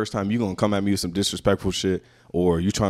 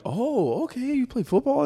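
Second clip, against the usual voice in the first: high, bright guest voice; slower than usual. Retold or imitated. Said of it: "they're like".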